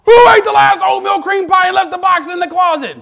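high, loud accusing demand; mock angry yell. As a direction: yell at the kids over the empty box. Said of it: "'Cause if I ask".

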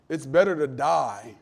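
ordinary delivery

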